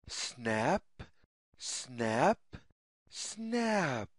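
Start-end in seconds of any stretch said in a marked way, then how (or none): none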